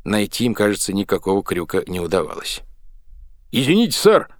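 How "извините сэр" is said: high, loud panting call; breathless shout. A narrator's reading. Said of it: "задыхаясь, крикнул боцман". (запыхавшийся крик боцмана)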